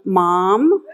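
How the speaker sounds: like a voice through a phone speaker; caller on speakerphone. Retold or imitated. Daughter calling over the speakerphone, mildly imitated. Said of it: "you hear this voice going".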